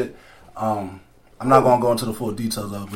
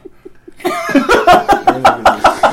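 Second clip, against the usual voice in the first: high, loud cackle; laughing fit; 6 dB louder than usual. Real, not acted. Host cracking up with co-hosts, high loud laugh. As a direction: burst out in a long, high laugh.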